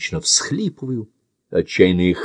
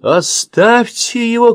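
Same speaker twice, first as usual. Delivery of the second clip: much higher, louder, drawn-out cry; shout; 5 dB louder, slower than usual. Acted. Andy yells at the man holding his friend, shouting. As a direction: scream at the man to stop. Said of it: "кричит Энди".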